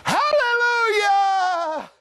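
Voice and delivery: high ringing hallelujahs, drawn out; joyful shouting. Retold or imitated. Angels in heaven rejoicing, high joyful shout.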